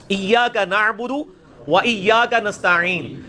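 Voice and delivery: "ایاک نعبد و ایاک نستعین" slowly, throaty ain; slow recitation. Shown as a demonstration. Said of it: "وہ نستعین نہیں ہے،".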